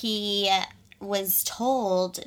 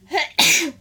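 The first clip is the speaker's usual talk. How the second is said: fake cough; 8 semitones higher, 8 dB louder than usual. Mimics a sick man coughing.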